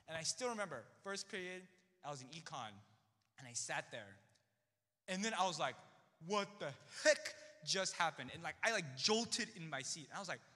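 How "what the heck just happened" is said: slow, louder stunned question; stunned disbelief. Retold or imitated. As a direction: drag it out in shock. Reacting in shock in class.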